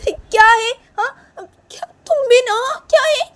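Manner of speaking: high, loud outburst; indignant cry of reproach; exasperated scolding. Acted. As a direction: snap at her in dismay. Scolding after the baby cries.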